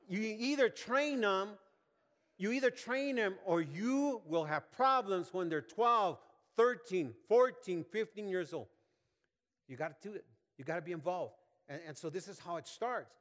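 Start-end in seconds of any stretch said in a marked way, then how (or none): 0.1-8.7 loud, slow, emphatic warning; preaching at full force. Real, not acted. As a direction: hammer out the warning to parents, slowly.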